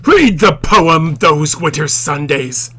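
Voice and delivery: loud, slow commanding yell; teacher shouting the example quote; acted scream. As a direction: bark the order slowly at full volume. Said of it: "he screamed".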